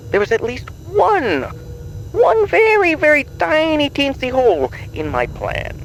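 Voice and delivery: put-on rising high and sing-song on very tiny teensy; a teasing falsetto swoop; mock-tiny. Villain mocking his tiny mistake.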